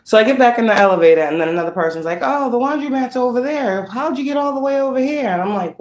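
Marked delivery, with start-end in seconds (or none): 2.2-5.3 chirpy stranger. Retold or imitated. Another person gives directions.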